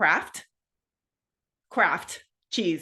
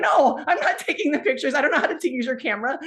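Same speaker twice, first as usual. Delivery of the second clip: higher, louder flustered refusal; protesting friend; 8 semitones higher, 5 dB louder than usual. Retold or imitated. Friend refusing to take wedding pictures, flustered protest. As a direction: push it away in a panic. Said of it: "He's like".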